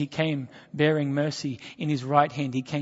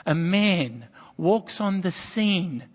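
ordinary delivery

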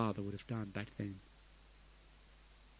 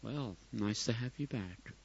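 put-on soft, reserved English accent; understated English dad, quietly polite; English father; 8 dB quieter than usual. English father greeting returning son, restrained English accent. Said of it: "If he was English".

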